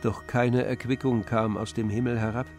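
ordinary talk, nothing marked

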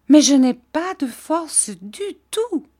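put-on high, loud girlish wail; plaintive high-pitched cry; distressed protest. A narrator's reading. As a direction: protest like a helpless child. Dora protests her weakness.

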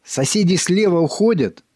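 breathless high urgent report; urgent panting report. A narrator's reading. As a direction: blurt it out panting. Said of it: "запыхавшись".

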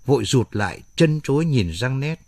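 ordinary delivery

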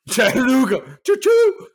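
high, loud train-whistle whoop; playful screaming. Pretend. Bettor hollering his team pick, playful screaming. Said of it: "Stop screaming into the microphone".

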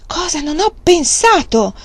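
high, loud sudden cry; a sudden excited shout. A narrator's reading. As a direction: burst out with a new idea. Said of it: "gridò rivolto alla donna".

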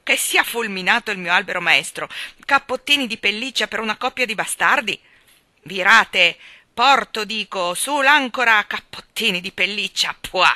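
loud sea-captain curses; blustering oaths. A narrator's reading. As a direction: roar out the sailor's swearing. Admiral curses about dog coats.

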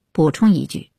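usual speaking voice

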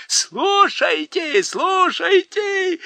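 very high, slow hooting cry; shouting creatures. A narrator's reading. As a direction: bellow like a foolish crowd. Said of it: "зашумели однотопы". (громкий хор, очень высоко, медленно)

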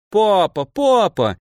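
high whiny plea; whining boy. A narrator's reading. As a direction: whine at father for the caps. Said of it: "законючил мальчик". (мальчик канючит у отца)

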